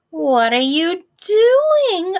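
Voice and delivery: high, loud, slow cackly witch. A narrator's reading. Teacher reading to class as the witch, high and loud. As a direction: ham up the witch's scolding question. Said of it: "she said".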